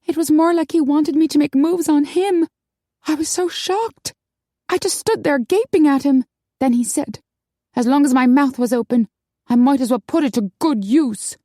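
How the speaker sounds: high, indignant character voice; Maggie, shocked. A narrator's reading. Shaken woman recounting a crude advance to friends, high and shocked. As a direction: tell it high and aghast.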